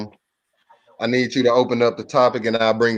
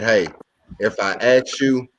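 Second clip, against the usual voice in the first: ordinary talk, nothing marked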